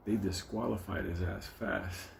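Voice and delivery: normal talking voice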